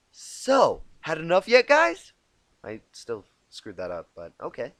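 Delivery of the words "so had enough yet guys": much higher, much louder taunting call. Acted. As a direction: yell the taunt across the field. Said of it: "Rainbow Dash's voice called out again".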